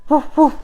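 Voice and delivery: playful woof woof, high and loud; dog barks. Pretend. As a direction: bark like a little dog. Imitating dog to a bird.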